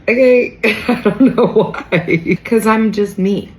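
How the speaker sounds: high, loud, awkward squeak with nervous laughter; acted-out uncomfortable. Fangirled over by followers, hammed-up discomfort. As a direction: play up squirming embarrassment. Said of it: "I get visibly uncomfortable".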